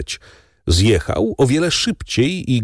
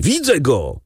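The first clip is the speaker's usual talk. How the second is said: raised-pitch call through the door; shouted alert; 6 semitones higher than usual. A narrator's reading. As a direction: call it out to the others. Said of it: "krzyknął głos za drzwiami". (okrzyk podniesionym głosem)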